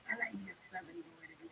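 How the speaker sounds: hushed confiding whisper. Real, not acted. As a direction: whisper it like a guilty secret. Confessing a crush, hushed.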